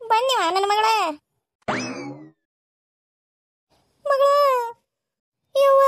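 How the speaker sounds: high, tearful wail; keen over her child; crying lament. Acted. Mother weeping over her daughter, high and tearful.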